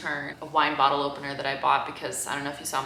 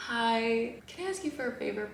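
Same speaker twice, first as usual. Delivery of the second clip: high, soft and quick little plea; butter him up with a tiny sweet voice; sweet request; 5 semitones higher, 5 dB quieter than usual. Retold or imitated. Asking the busy delivery driver a favor, sweetly high and soft.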